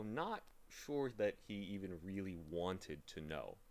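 plain reading voice

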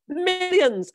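greedy exclamation. A narrator's reading. Greedy father stressing the worth.

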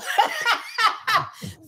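high burst of laughing. Real, not acted. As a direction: crack up at the memory. Laughing at his crying face.

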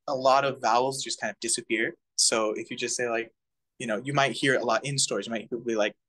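ordinary talk, nothing marked

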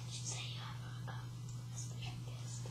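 hushed secret whisper. Acted. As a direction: whisper it to the camera.

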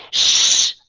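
put-on loud voiceless Shh at noisy girls in a library; annoyed shush. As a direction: shush them, irritated. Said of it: "đọc có vẻ tức giận".

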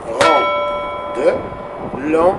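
very slow, each syllable stretched long; drawn-out syllables. Shown as a demonstration. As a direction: stretch every syllable out slowly. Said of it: "Regarde bien ça". (syllabes très lentes et étirées)

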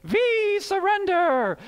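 high, slow, hammed-up French accent; bad French accent. Pastor imitating cartoon mice, bad French accent. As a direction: cry it out like a cartoon French mouse. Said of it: "It was a bad French accent. I tried".

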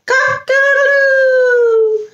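high stretched-out rooster call; rooster crow. Pretend. Rooster recap.

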